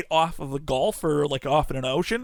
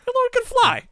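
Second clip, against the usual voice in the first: much higher, mock-incredulous squeak; squeaky high voice; 3 dB louder, faster than usual. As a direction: pitch it way up in disbelief. Repeats the line in a squeak.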